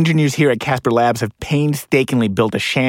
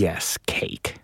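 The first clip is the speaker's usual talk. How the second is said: whisper; 4 semitones lower, 8 dB quieter than usual. Acted. Hushed insistence.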